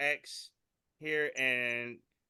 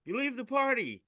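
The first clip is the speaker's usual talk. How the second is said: dragon game master voice; 12 semitones higher, 5 dB louder than usual. Retold or imitated. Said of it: "blue one says".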